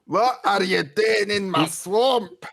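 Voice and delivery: slow, bellowing Scottish ogre; Shrek impression. Mocking the accent by doing Shrek guarding his swamp. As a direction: drawl it out like an angry ogre. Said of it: "sounding like Shrek".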